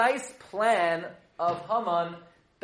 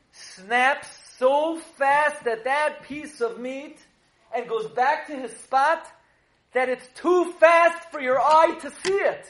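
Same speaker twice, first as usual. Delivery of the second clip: raised, high, emphatic storytelling; excited climax; 8 semitones higher, 7 dB louder, slower than usual. Real, not acted. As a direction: build to a loud, amazed peak.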